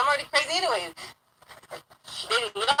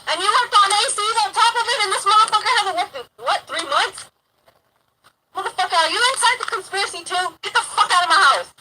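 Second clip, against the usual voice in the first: indignant rant climbing higher and louder; retold angry rant; 5 semitones higher, 3 dB louder than usual. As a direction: pile up accusations, building to an outburst. Retold rant at housemate, building.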